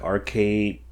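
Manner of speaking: usual speaking voice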